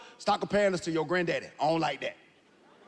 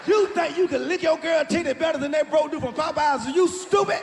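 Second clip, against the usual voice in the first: loud, high taunt at the cheater; mock shouting; 7 semitones higher, 4 dB louder than usual. Comic taunting a cheating boyfriend, loud and mocking. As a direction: yell it like you can't believe him.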